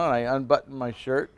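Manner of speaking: ordinary delivery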